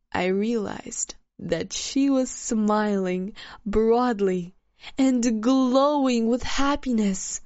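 ordinary delivery, nothing marked

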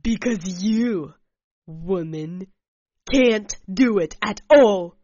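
loud, bitter jab; angry through teeth. Acted. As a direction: spit it out through his teeth. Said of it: "through his teeth angrily".

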